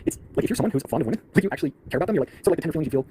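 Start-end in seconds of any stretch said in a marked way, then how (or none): none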